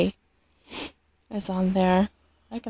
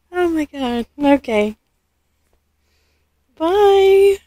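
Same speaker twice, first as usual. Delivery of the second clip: ordinary talk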